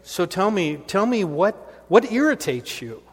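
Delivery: eager pastor questions. Retold or imitated. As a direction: coax her to name what bugs her, keenly. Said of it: "And I'm like".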